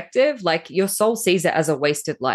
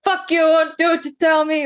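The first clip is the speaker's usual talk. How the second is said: much higher, louder furious yell; defiant shout; 13 semitones higher, 4 dB louder than usual. Retold or imitated. Stubborn soul yelling back at despair, high loud shout. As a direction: spit it out, screaming defiance. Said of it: "It's like".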